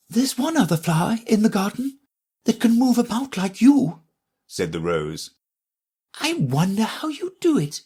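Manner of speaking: high, fussy flower voice; lofty little lady flower, talking down; prim Rose. Acted.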